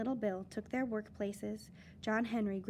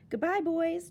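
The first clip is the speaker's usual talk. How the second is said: higher, louder cheerful farewell; calling goodbye; 7 semitones higher, 6 dB louder than usual. Acted. John Henry calls goodbye to his friends, loud and high. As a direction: call it out to the boys. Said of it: "cried John Henry".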